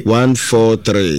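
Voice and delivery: slow dictation. Acted. Repeating caller's number slowly.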